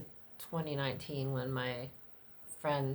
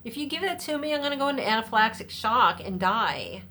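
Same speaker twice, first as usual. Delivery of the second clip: sassy retort; 7 semitones higher, 8 dB louder than usual. Retold or imitated. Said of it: "I'm like".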